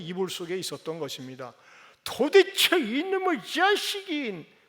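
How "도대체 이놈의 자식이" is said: slow, much higher and louder angry cry; 욱해서 터뜨리는 고함; furious outburst. Acted. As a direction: explode at the child. Father furious at son.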